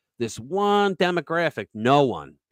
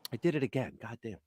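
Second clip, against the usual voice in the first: muttered to self; 2 semitones lower, 12 dB quieter than usual. Real, not acted. Self-reproach after a verbal slip.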